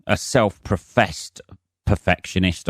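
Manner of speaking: normal talking voice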